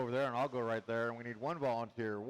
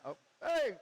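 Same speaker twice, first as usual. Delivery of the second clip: much higher startled call; surprised; 13 semitones higher, 3 dB louder than usual. Real, not acted. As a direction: jump as the car comes close. Greets car nearly hitting him.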